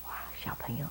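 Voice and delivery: softly whispered wonder. Real, not acted. Hushed aside to children.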